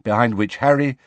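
plain reading voice

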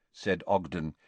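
ordinary delivery, nothing marked

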